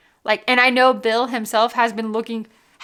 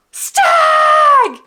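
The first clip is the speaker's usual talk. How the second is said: shrieked out at full volume, much higher; screamed; 8 dB louder than usual. Retold or imitated. Scream at spotting the bottle across the store. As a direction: scream the bottle's name in delight. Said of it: "sorry if I just broke your ears".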